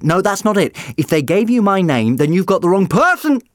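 high, loud insistence; high loud protest. Acted. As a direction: protest it urgently. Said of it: "the doctor protested".

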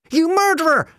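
high, loud accusation; furious yell. A narrator's reading. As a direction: scream it as you launch at him. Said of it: "Jim yelled".

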